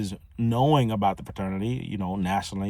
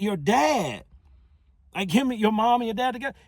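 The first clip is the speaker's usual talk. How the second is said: frustrated; 13 semitones higher than usual. Retold or imitated. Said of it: "I'm getting frustrated with him".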